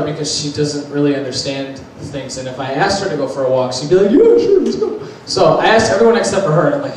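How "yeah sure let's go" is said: high, excited imitation of grandma; eager grandmother. Grandmother agreeing to a walk, high-pitched mock excitement. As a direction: squeal a mock-eager yes, laughing.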